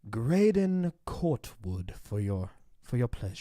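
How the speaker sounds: slow, grand announcer voice; regal announcer. Pretend. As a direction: announce him like royalty.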